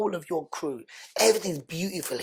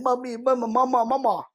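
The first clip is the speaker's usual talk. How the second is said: put-on higher, plaintive child's call for mummy; children crying out; 5 semitones higher than usual. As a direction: call after your mother as the van pulls away. Kids calling mother from van.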